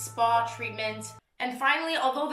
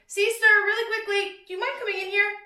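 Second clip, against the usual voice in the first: raised, high calling voice; 9 semitones higher, 5 dB louder, faster than usual. Real, not acted. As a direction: call to someone in another room. Calling sister into room.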